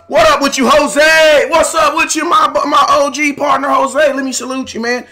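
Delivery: high, hyped-up greeting; big high-energy hello; excited shout-out. Real, not acted.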